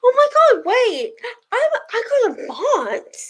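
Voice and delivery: high, loud delighted squeal; real surprised joy; excited surprise. Real, not acted. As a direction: gasp it out, thrilled by the news. Surprised by getting a bot.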